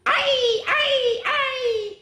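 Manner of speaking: very high squeals; silly high yips; squeaky ay ay ay. Acted. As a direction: yip it high. Silly sounds.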